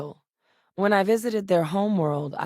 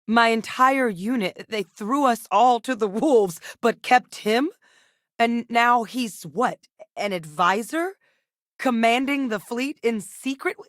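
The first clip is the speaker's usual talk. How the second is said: louder, higher, bitter and indignant; angry outburst; 6 semitones higher, 4 dB louder than usual. Acted. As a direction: let the betrayal boil over. Bitter outburst about betrayal.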